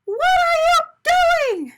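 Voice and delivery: very high, loud, slow alarmed scream; terrified donut shout; panicked shout. A narrator's reading. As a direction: shriek in horror at being eaten. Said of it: "shouted Arnie".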